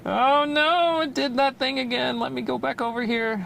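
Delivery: high-pitched exasperated dismay. Real, not acted. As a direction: wail lightly at a glitch. Dismay as the equipment misbehaves.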